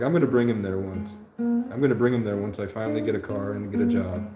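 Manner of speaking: ordinary delivery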